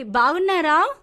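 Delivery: slow, loud check-in with rising end. Real, not acted. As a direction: repeat it loudly for a poor line. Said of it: "మీ voice సరిగ్గా రావట్లేదమ్మా".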